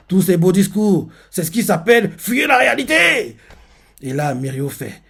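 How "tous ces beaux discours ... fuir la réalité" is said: cri méprisant. Retold or imitated. L'héritier frustré crie.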